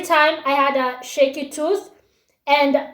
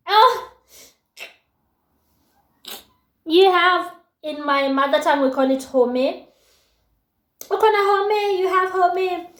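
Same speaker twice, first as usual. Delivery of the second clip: cooing admirer; 4 semitones higher than usual. Acted. Guys admire her gapped teeth, mock cooing. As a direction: imitate guys gushing over something cute. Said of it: "who think it's cute".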